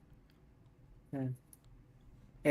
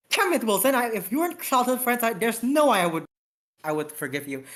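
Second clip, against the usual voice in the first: higher, raised mock anger; mock angry outburst; 7 semitones higher than usual. Captain snaps at childhood friend, higher mock anger. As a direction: snap at your old friend, voice up.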